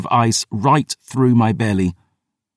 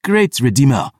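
higher and louder, exasperated outburst; exasperated cry; 5 semitones higher, 3 dB louder than usual. A narrator's reading. Vampire historian cries out in exasperation. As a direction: throw it at the ceiling in exasperation. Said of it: "the vampire cried".

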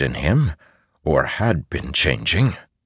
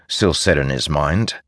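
plain reading voice

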